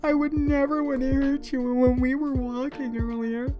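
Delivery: high, drawn-out tearful whine; mock crying. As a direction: imitate a sobbing partner, hammed up and slow. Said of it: "they're, like, crying".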